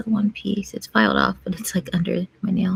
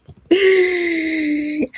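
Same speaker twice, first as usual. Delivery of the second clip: excited gasp; 10 semitones higher, 7 dB louder than usual. Real, not acted. Thrilled about the results.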